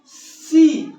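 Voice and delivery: ordinary talk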